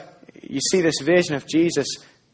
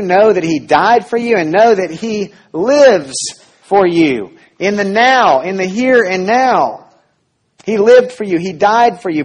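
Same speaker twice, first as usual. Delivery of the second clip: louder, higher, driving the point home; preaching at full force; 5 semitones higher, 6 dB louder than usual. Acted. Pastor urging the congregation, full-force preaching. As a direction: preach it with growing fervor.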